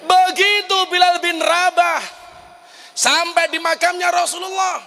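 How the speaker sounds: high, loud, sustained declamation; ringing high-pitched storytelling cry; impassioned preaching cry. Real, not acted. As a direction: proclaim the arrival at the top of the voice. Impassioned telling of Bilal's arrival.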